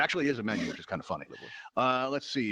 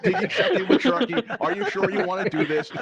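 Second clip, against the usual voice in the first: laughing; 6 semitones higher, 6 dB louder than usual. Retold or imitated. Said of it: "I was like".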